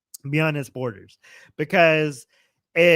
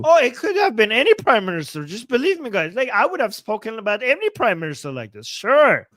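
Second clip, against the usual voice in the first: higher-pitched mock pleading; sarcastic imitation; 6 semitones higher, 4 dB louder than usual. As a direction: feign earnest excuses, dripping with disbelief. Sarcastically voices the clip speaker's excuse.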